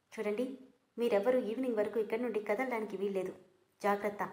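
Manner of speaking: usual speaking voice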